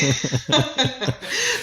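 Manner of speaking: a bright burst of laughter at the joke; hearty laugh. Real, not acted. Laughing at the interviewer's hut joke, hearty laugh.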